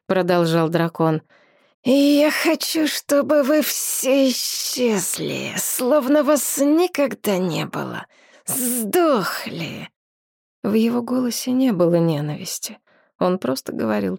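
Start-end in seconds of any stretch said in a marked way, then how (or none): 1.8-9.9 menacing dragon voice. A narrator's reading. Dragon curses them.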